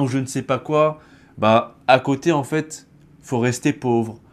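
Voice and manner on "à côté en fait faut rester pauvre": normal talking voice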